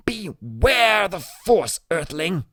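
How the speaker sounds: slow, high kid's hammed-up Vader command; a small boy playing the Dark Lord; mock Darth Vader. Boy in a Vader costume commanding his friend, hammed-up villain voice.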